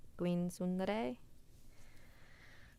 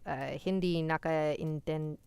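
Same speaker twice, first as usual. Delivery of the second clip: ordinary delivery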